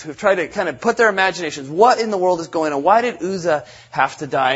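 ordinary delivery

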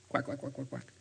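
soft quacks mimicking chatter; mock quacking. Preacher mimicking friends heading off together, quacking.

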